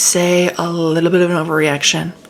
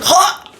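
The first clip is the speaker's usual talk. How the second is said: mock gasp; 12 semitones higher than usual. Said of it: "She can't breathe".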